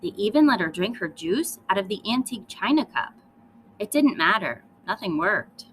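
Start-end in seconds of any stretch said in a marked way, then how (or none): none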